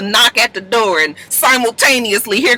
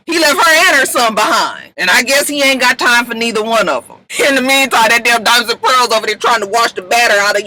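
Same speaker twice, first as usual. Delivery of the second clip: ordinary talk, nothing marked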